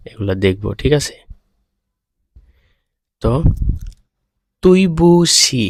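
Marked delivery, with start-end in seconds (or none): 4.6-5.6 Chinese duì bu qǐ said slowly, syllable by syllable; slow teacher model of তুই বুসি; slow Chinese demo. Shown as a demonstration. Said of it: "এখানে তিনটা অক্ষর আছে".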